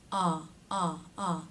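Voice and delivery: "ah" said three times, each with the jaw dropped open; repeated ah demo. Shown as a demonstration. Teacher drilling the ah sound for students, open and unhurried. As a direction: model "ah, ah, ah" slowly with a wide-open mouth. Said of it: "The tongue presses down".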